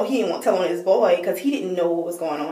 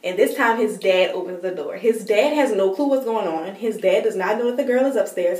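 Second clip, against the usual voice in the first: ordinary talk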